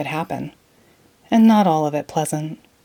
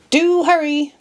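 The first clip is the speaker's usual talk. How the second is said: cried out; 8 semitones higher than usual. Acted. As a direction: hurry her along from above.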